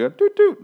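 tiny, high, loud horn beep; mock horn toot. Mocking the missing warning before he reverses, a high horn toot. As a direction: chirp it like a toy car horn. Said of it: "not like a honk".

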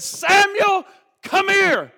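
furious father's shout, high and loud; loud, high bellow of an angry dad; angry scream. Retold or imitated. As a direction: yell for the boy to come at once. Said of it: "he screams to the boy".

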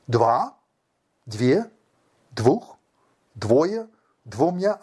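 each form said apart, rising through the list; emphatic one-word items with pauses between. Shown as a demonstration. Man reciting Russian forms of two, emphatic listing.

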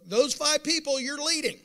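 high, loud declaration; preaching shout. Real, not acted. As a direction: hammer it home to the congregation. Preacher punches the point.

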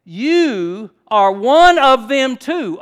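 "You are one of them too" very loud, high and slow; emphatic accusation. Shown as a demonstration. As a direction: stretch and punch "of them too". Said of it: "Of them too is emphatic".

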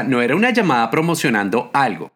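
ordinary talk, nothing marked

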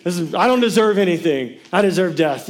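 overdone self-condemnation; hammed-up midnight panic; mock despair. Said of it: "I was like".

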